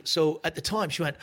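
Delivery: usual speaking voice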